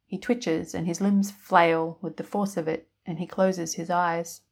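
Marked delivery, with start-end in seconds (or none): none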